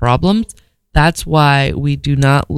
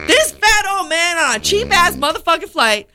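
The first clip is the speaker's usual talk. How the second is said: much higher mocking voice; mock pilots' voice; 13 semitones higher than usual. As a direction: squeak it out like a scandalized crew. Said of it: "So they're like".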